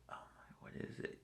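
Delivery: voiceless mutter to self; whispered aside. Real, not acted. Traveler whispering to himself while hunting for German. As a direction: whisper it while searching for the word.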